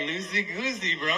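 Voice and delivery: high laughing throwback line; stoned retort. Retold or imitated. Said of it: "I'm like".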